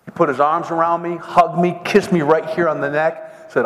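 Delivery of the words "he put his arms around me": usual speaking voice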